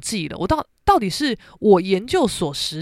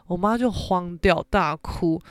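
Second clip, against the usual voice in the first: ordinary talk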